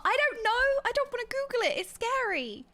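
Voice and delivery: high, alarmed protest; squealed refusal. Real, not acted. Streamer refusing chat's dare to look it up, alarmed. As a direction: shoot the pitch up in comic alarm.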